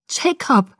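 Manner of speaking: restrained high scream; screamed name. A narrator's reading. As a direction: cry out his name in alarm. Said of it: "schrie ich wieder".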